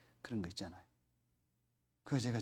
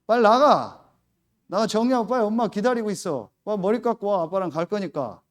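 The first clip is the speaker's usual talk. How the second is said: loud, higher barked commands; father ordering his daughter to get ready; angry orders; 5 semitones higher, 8 dB louder than usual. Retold or imitated. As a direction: order her out now.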